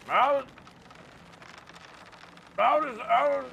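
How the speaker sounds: high, loud taunting calls; taunting captor calling names; menacing villain voice. Acted. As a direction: call out like a gleeful villain.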